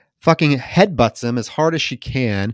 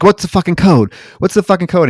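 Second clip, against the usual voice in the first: higher and louder repeated demand; angry demand; 7 semitones higher, 4 dB louder than usual. Retold or imitated. Captive woman demanding the door code from him, angry and loud. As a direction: bark the question twice. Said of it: "and she's like".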